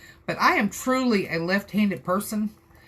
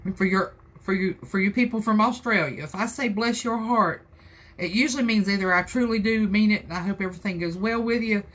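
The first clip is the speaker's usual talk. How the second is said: normal talking voice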